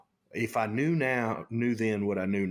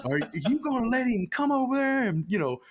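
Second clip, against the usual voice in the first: much higher, louder mock outrage; alarmed shout; 13 semitones higher, 3 dB louder than usual. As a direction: shout it in disbelief. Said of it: "He said".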